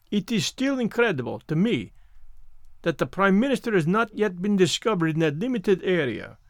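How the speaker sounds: put-on French-accented detective, measured and sceptical; Poirot, doubtful. Poirot answers his friend about the search, sceptical, French-accented.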